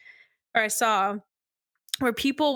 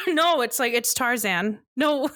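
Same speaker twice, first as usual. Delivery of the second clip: usual speaking voice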